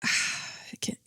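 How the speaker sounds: breathy, unvoiced sigh of defeat; sighed whisper. Real, not acted. Vlogger giving up on the point, whispered sigh. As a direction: let it out on an exhale.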